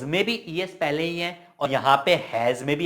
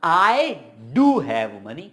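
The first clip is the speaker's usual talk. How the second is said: "I" punched loud, then "do have money" drawn out; emphatic stress; 5 dB louder, much slower than usual. Shown as a demonstration. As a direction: lean hard on "I do" with swaggering insistence. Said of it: "जोर देके बोलूंगा".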